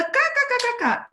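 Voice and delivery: high mock giggle; friend's giggle. Friend laughing into the phone, mock giggle. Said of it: "E ela".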